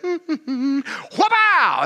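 whip crack. Retold or imitated.

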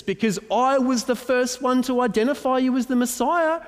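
high, loud mock outrage as Peter; indignant Peter. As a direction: play Peter demanding his seat. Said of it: "he's going".

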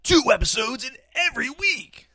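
high, loud salesman pitch; car salesman voice. Acted. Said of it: "That was my car salesman voice".

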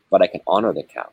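ordinary delivery

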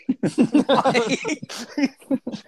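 laughing hard up high; a loud high cackle; high burst of laughter. Real, not acted. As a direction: crack up at the line. Big laugh after punchline.

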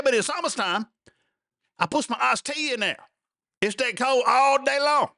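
hammed-up yokel making his point; high put-on country voice; old country boy.

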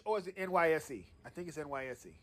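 quiet aside. Real, not acted. Host second-guessing the acronym to himself, hushed and quick. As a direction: drop the voice to check yourself. Doubting aside after the shout.

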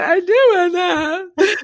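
high, slow, sobbing words; tearful wail of wanting; retold ugly crying. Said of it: "I'm, like, ugly crying".

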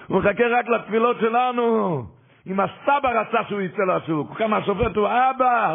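high, loud, impassioned preaching, slowing on אבא; fiery preaching. Acted. Preacher rousing the congregation about the judge being their father, impassioned and loud. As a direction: preach it with full force, hammering the point home. Preacher driving home the lesson.

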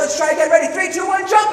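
high, loud rallying cry; excited countdown. Retold or imitated. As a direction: imitate kids cheering on another try. Siblings' repeated countdown.

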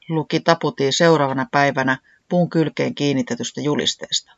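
usual speaking voice

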